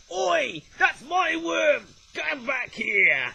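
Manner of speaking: high, loud mock squawking yell; hammed-up gull shouting, high and slow at the end; angry seagull. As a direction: shout like a gull robbing back its worm. Seagull voiced chasing off a rival.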